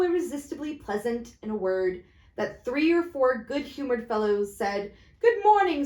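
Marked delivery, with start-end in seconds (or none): 5.2-5.8 raised hearty greeting; cheery passers-by. Acted. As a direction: call good morning brightly.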